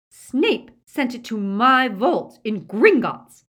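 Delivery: very loud, high, slow and emphatic; furious insistence. A narrator's reading. Witch confronts captive over her stolen sword, loud furious insistence. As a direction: hammer out each word in outrage.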